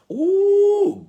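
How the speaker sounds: sudden high preacher's "Ooh"; ecstatic high cry. Real, not acted. Preacher crying out to the congregation, high ecstatic whoop. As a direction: cry out high and loud in the spirit.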